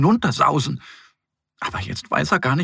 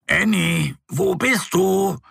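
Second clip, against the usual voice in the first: calling out; 7 semitones higher, slower than usual. A narrator's reading. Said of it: "schreit da jemand".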